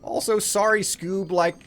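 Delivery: high, apologetic cartoon slacker; Shaggy. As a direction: say sorry to the dog in a high goofy voice. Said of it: "Shaggy from Scooby-Doo".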